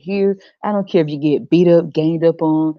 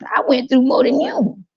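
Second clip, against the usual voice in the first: high mocking whine; mock people bragging about suffering; a hint of a petty boast; competitive whine; 8 semitones higher than usual. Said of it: "competing and comparing".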